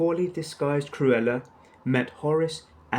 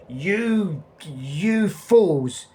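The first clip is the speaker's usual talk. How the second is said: much higher, louder outburst of rage; furious growl; 5 semitones higher, 5 dB louder, much slower than usual. Acted. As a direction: snarl at the fools. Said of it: "she growled".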